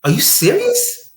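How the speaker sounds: high, slow, stunned what; shocked disbelief. Retold or imitated. Girlfriend hearing a shocking answer: high, slow disbelief. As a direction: stretch it out in disbelief. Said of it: "And she said".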